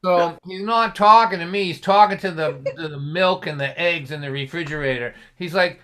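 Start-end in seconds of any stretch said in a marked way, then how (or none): none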